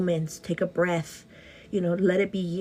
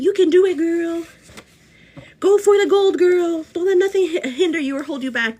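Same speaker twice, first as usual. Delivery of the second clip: much higher, louder cheering pep talk; hype cheer; 8 semitones higher, 8 dB louder than usual. Retold or imitated. Quoting her own Instagram stories to fans, loud cheer.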